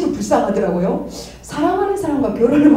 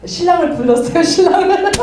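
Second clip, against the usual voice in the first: laughing; 9 semitones higher, 5 dB louder than usual. Real, not acted. Laughing about calling late husband.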